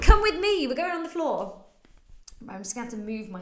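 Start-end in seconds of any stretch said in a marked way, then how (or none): 0.0-1.6 excited. Real, not acted. Cheery invitation down to floor.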